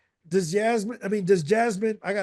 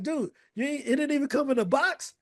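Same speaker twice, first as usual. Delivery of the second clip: mock incredulous customer; 6 semitones higher than usual. Imagined buyer complaining at store.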